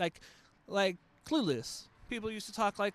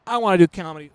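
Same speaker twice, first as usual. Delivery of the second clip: exclaiming; 15 dB louder, faster than usual. Retold or imitated. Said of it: "I was like".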